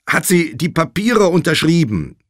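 loud, high insistent question; urgent demanding. A narrator's reading. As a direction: demand it loudly down the phone. Josh presses groggy Nate.

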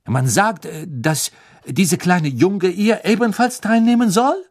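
put-on a young Frenchwoman protesting; French girl voice. Fleur's voice, French-accented.

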